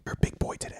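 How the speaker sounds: voiceless, hushed whisper; whispered praise. Acted. Other dealmaker congratulating the signer afterwards, whispering. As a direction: whisper it as to a small child.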